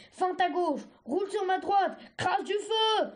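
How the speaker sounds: high, urgent combat commands; barked orders. A narrator's reading. Red dragonet drilling Argile mid-fight, barked orders. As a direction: call out moves mid-fight, building in pitch.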